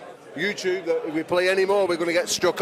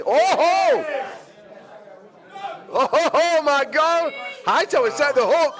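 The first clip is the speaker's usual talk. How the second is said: excited commentary; 9 semitones higher than usual. Real, not acted. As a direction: call the big moment at full voice. Live excited match call.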